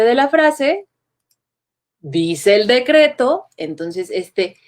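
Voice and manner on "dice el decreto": slow, rising tune on decreto; rising intonation. Shown as a demonstration. Teacher showing students a rising curve, slow and rising. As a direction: draw out decreto, rising at the end. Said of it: "sube al final".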